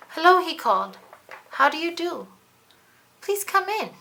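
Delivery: high, loud, sugary welcome; overly sweet host hiding a trap; kindly greeting. Acted. Space Monkey greets his guests with false kindness, high and sweet. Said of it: "Although he spoke kindly".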